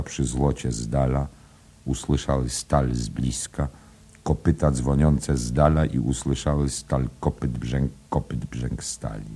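ordinary delivery, nothing marked

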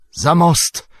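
high, loud urgent command; Gandalf's shout. A narrator's reading. Wizard orders companions over the bridge, urgent shout. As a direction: shout the order with regained energy. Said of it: "krzyknął Gandalf, odzyskując energię".

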